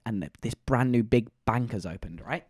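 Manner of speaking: ordinary talk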